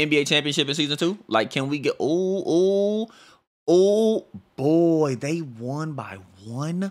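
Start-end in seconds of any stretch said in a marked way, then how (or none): 2.0-6.9 high, drawn-out oohs settling into disbelief; floored by a shock result; stunned reaction. Real, not acted. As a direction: wail it loudly, then slow right down. Reacts to shock one-point result.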